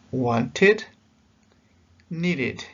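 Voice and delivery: "wanted, needed" each in two clear syllables; extra-syllable "it" ending. Shown as a demonstration. Teacher giving examples of the it sound, "wanted, needed" with the ending fully pronounced. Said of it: "El sonido es it".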